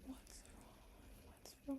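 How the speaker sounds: "What's wrong?" whispered, hushed and anxious; whispered worry. Retold or imitated. Friends fussing over her tears, whispered concern. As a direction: lean in and hush the question. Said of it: "They're like".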